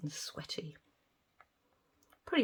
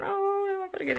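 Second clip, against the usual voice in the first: a hint of higher-pitched worried self-talk; anxious inner voice; 14 semitones higher than usual. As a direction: voice the nervous thought lightly.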